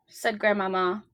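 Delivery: usual speaking voice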